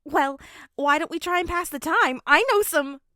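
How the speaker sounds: high, loud, chirpy suggestion; cheerful dad pitching a sing-along, high and loud; eager pitch. Acted. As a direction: sell the idea brightly to the car. Hisashi eagerly proposes a game.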